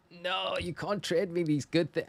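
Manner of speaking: high-pitched flustered protest. Real, not acted. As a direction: squeak out a protest at the gift. Protesting gift of shinies.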